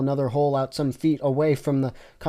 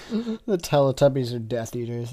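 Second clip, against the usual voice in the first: usual speaking voice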